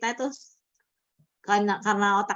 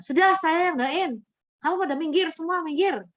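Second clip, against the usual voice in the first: bossy church member; 9 semitones higher than usual. Retold or imitated. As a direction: mimic her taking charge, pitched way up. Church member takes charge at possession.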